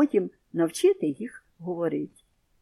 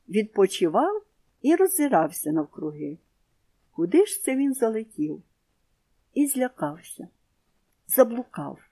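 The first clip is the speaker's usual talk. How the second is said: plain reading voice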